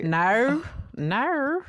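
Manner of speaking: exaggerated Aussie "no"; Australian accent. Podcaster showing off a bit, hammed-up Australian accent. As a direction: stretch "no" into a mock Australian vowel. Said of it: "about my Australian accent".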